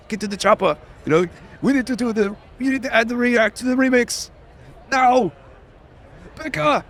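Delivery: high-pitched, strained action-hero impression; urgent movie one-liner delivery in a mock Austrian accent; Arnold. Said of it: "what about, like, Arnold?".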